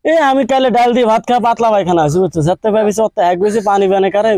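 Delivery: ordinary talk, nothing marked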